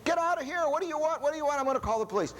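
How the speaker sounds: high, shrill mock scream of a startled guest; retold scream. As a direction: yell it like someone woken by a stranger at the door. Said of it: "Somebody screams from inside the room".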